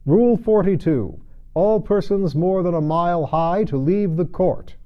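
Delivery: loud, high, self-important king reading out; pompous royal decree. A narrator's reading. King to the court, loudly proclaiming his rule.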